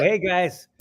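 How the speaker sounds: hey guys like a polite nerd. Retold or imitated.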